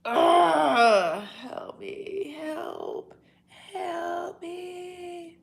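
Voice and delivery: high, hammed-up wail for help; mock cry for help. Streamer hams up a panicked cry for help.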